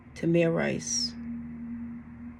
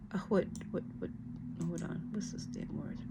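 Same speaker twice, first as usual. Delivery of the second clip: much softer, barely voiced; whispered muttering; 17 dB quieter than usual. Real, not acted. Stuck on a word, whispering to herself.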